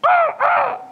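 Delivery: loud, very high squawking caw; raven caw. Retold or imitated. Raven heckling the pastor, loud high caw. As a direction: screech it out like a crow on a branch. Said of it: "I hear this loud noise, uh, a raven".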